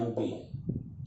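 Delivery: quiet, slow, almost whispered MP; teacher murmuring the abbreviation; soft, slow aside. Shown as a demonstration. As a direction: let MP fade, slow and breathy. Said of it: "देखो उच्चारण क्या कर रहा हूँ मैं".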